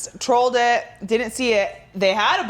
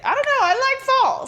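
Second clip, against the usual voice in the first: high-pitched excuse. Real, not acted. Sheepishly excusing wanting more candy.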